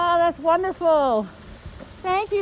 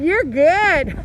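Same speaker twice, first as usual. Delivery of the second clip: ordinary talk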